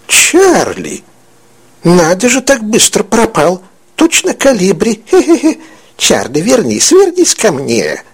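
a witch gleeful at her own trick; delighted old witch. Acted. Old woman marvelling at vanished Charlie, cackling crone.

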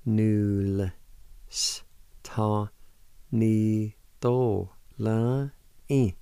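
"Null s ta ni do la i" slow, each sound apart; syllable-by-syllable pronunciation. Shown as a demonstration. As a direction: sound it out with long pauses. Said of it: "pronounce the L sound with the N U like this".

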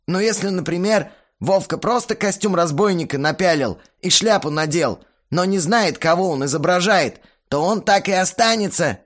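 earnest lecturing voice. A narrator's reading. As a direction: argue the point earnestly. Belfigor's lines voiced.

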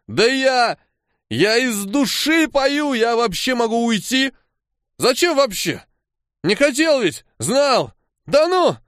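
high-pitched, drawn-out protest; wounded, boozy outburst; drunken indignation. A narrator's reading. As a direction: wail your offence like a hurt drunk. Offended drunk protests loudly.

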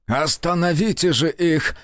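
host begging the official to halt the search; exclaiming. Acted. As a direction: cry out for them to stop. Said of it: "воскликнул он".

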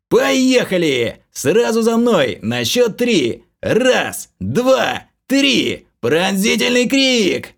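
loud, slow, drawn-out battle command; shouting. A narrator's reading. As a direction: bellow the countdown to the team. Leader shouting countdown.

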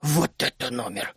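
low, grumbling indignation; old gnome outraged at the girl; indignant gnome. Acted. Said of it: "возмутился Берлан".

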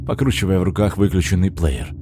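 plain reading voice

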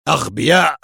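sharp angry insult; sharp interruption. A narrator's reading. Boss interrupts the failed report, sharp and angry. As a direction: cut in curtly with contempt. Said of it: "قاطعها في حدة".

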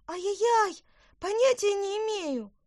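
put-on very high, loud girlish wail; panicked cry. A narrator's reading. Girl pelted with peas, high panicked cry. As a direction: cry out under attack.

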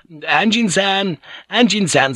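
high, drawn-out call; high-pitched call to someone inside. A narrator's reading. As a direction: call the name politely through a door. Calling after knocking at door.

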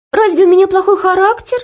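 high, loud offended question; indignant. Acted. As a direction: take offence loudly. Offended girl objecting.

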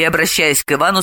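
usual speaking voice

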